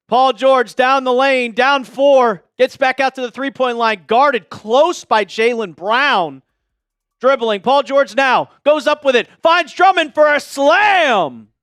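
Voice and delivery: broadcaster call ending on a slow "slam"; live play-by-play. Acted. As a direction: build to the dunk. Calls the game live.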